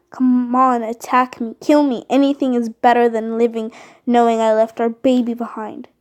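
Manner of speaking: loud, raw taunting; furious challenge. Acted. Grieving mother daring an enemy to kill her, loud furious taunt. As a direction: dare them to strike, voice raw. Grieving mate begs to be killed.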